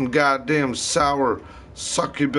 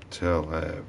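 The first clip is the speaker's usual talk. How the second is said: softer, lower repeat; quiet; 10 semitones lower, 7 dB quieter, much faster than usual. Shown as a demonstration. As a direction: drop it to a murmur. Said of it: "Just tell everybody".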